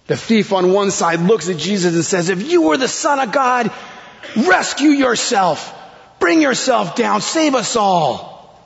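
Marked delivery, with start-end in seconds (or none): 2.3-8.6 high-pitched taunting challenge; shouted insult. Retold or imitated. Thief jeering at Jesus on the cross, shouting high. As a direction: call it out high, drawing out the last words. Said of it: "Everyone is shouting insults at Jesus".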